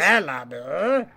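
robot voice. Pretend. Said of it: "in his robot voice".

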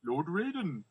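put-on actor voice, pitched for effect; George Takei. Movie riffer addressing Lord Raiden, imitation voice. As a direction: do your best imitation, in an altered timbre. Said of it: "I'm trying to do my best George Takei".

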